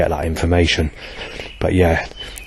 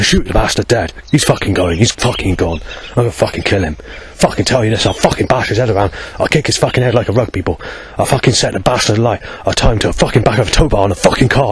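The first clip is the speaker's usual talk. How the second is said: put-on higher-pitched posh upper-class accent, ranting threats; posh threatening accent; 4 semitones higher than usual. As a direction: sneer out violent threats in a posh English accent. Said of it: "very posh, well-off sort of accent, which is like this".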